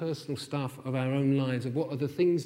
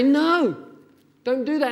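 put-on loud, high, stern stop to a child; firm parental no; 9 semitones higher, 8 dB louder than usual. Parent firmly stopping a self-destructive child, loud stern no. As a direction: put your foot down with a hurting child. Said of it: "I have to say".

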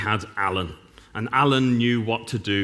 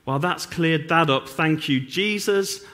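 higher, dry mock gratitude; sarcastic thanks; 6 semitones higher than usual. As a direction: pretend it's all crystal clear, tongue in cheek. Mock relief after riddle answer.

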